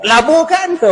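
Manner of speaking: high incredulous echo of laburkan; surprised echo. Real, not acted. Preacher echoing the companion's answer in surprise. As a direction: leaps up in disbelief.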